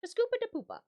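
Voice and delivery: high, sing-song mock Swedish Chef; lightly voiced Muppet chef babble. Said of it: "in the voice of the Swedish chef from the Muppets".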